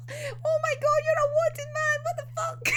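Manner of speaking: hammed-up shocked girl voice; high mock Lucy. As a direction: squeak it out like a flustered girl. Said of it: "actually be like".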